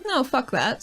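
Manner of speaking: usual speaking voice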